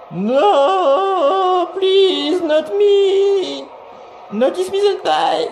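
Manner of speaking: high squeaky pleading; shrill cartoon plea, very high; overacted anime dub. Squeaky voice for character dying.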